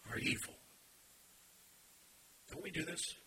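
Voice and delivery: whispered accusation. Acted. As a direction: drop to a whisper on the verdict. Hushed end of preached rebuke.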